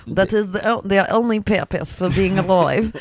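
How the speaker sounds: badly faked Russian; bad Russian accent. Host attempting Russian dealer, terribly faked Russian accent. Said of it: "That is the worst accent I've ever heard".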